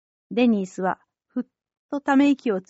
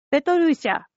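calling out; 5 semitones higher than usual. A narrator's reading. Old Denis calling to Petrusha in the boat, raised high. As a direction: call the name high across the ice. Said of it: "とデニースが叫んだ".

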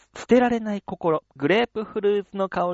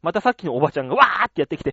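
usual speaking voice

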